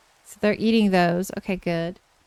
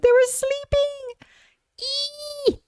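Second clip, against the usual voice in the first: high squeal; 4 dB quieter, much slower than usual. Real, not acted. Squeal at cuteness.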